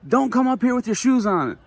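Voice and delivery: high mock scold; imitating yelling lady. Vlogger translating the lady's scolding, high mocking voice. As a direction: translate her scolding in a squeaky voice. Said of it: "just yells at me".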